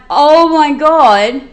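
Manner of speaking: slow, loud gasp of realization; stunned awe. Retold or imitated. Paul on the ground realizing Jesus is real, awestruck. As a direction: let it dawn on you, amazed.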